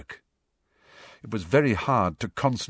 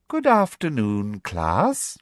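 sugary Umbridge voice; slower than usual. A narrator's reading. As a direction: greet the class with sickly sweetness. Umbridge greets the class sweetly.

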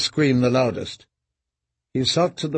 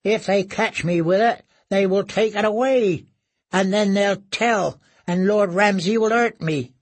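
higher, anxious broken voice; frightened prisoner voice, higher; fearful captive; 6 semitones higher than usual. Acted. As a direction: fret in terror of punishment. Reek's fearful thoughts.

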